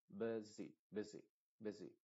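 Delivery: busy said BIH-zee; English busy. Shown as a demonstration. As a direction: say busy with a short first IH and clear final EE. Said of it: "La última I sí es clarita".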